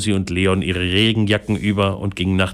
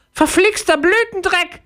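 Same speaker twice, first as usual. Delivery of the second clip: tiny, much higher fairy curse; cursing fairy. A narrator's reading. Grumpy fairy in tent curses the rain. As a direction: spit out the curse in a squeaky fairy voice. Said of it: "flugte es".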